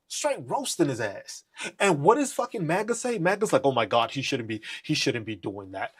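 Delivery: normal talking voice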